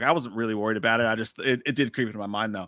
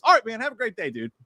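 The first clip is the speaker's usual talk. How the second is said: lightly imitated cheery cop, pitched up; friendly cop; 7 semitones higher than usual. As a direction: send them off breezily. Friendly cop's goodbye voiced.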